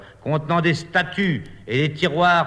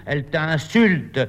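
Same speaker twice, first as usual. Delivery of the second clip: ordinary delivery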